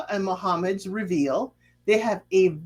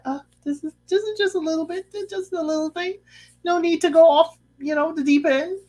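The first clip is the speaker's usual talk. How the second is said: mock modest guy; 8 semitones higher, faster than usual. Simo voiced brushing off praise.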